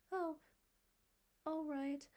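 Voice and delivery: put-on high little boy, calming down; a boy accepting Marilla's terms, half consoled; somewhat comforted boy. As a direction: give in like a child soothed. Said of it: "somewhat comforted".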